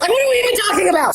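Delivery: high, fast, loud outburst; incredulous shout. Real, not acted. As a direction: yell it in disbelief. Disbelief at silly chat.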